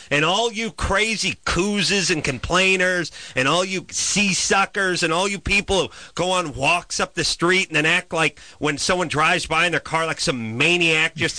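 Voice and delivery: normal talking voice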